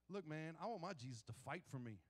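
normal talking voice

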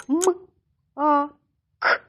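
short separate М, а, к; three crisp isolated sounds М, а, к; sounding out. Shown as a demonstration. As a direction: say М... а... к high and loud, one by one.

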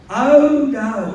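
high, loud fretting mother. Retold or imitated.

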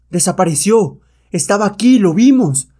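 loud, high protest; baffled shout. Acted. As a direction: insist loudly that you just saw him. Guards baffled by vanished man.

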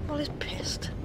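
hushed whispered aside. Real, not acted. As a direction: whisper it behind a hand.

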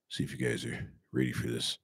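low, quiet real estate agent voice; smooth realtor pitch, low and soft. Pretend. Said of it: "let me read this in my real estate voice".

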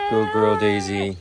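loud, drawn-out praise; slow, doting praise for an animal; praising a pet. Real, not acted. Keeper rewarding Daisy the pig for sitting, drawn-out praise.

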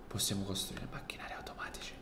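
secretive whisper; hushed conspiratorial aside. Acted. Sharing secret plans, whispering.

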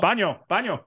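put-on Spanish accent. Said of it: "So you just".

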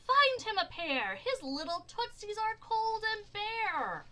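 loud, high storybook call; high-pitched holler. A narrator's reading. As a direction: call the order out to the crowd. Mayor calls out an order.

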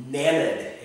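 Nenad said as NEH-nahd, short first vowel; correct pronunciation. Shown as a demonstration. Vlogger showing viewers how this is pronounced, correct NEH-nad. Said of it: "It's not Nenad, it's Nenad".

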